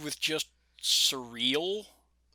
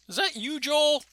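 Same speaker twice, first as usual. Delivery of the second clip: high quoted voice; 12 semitones higher, 4 dB louder than usual. Retold or imitated. Quoting a character from the show.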